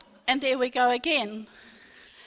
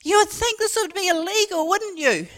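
higher-pitched indignant mock yell; retold yell; 6 semitones higher, 4 dB louder than usual. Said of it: "And I yell at them".

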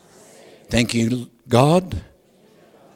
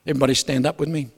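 ordinary delivery

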